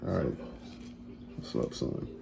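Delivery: whispered hello to his son; whispered aside. Real, not acted. Greeting son again aside.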